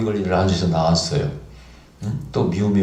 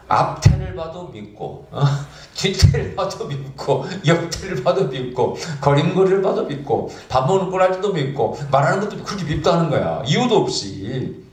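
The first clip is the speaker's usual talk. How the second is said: high, slow, half-laughing mimic of a wife who hates her husband; imitating the wife; 7 semitones higher than usual. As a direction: drag out each complaint in a higher voice, amused. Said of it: "뭐라고 하는지 아세요?". (남편이 밉다는 아내를 높고 느리게 흉내 내며 웃음 섞어)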